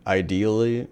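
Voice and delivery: normal talking voice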